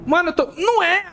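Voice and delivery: high panicked shout; panicking gamer. Real, not acted. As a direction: yell in panic, completely lost. Lost in a horror game.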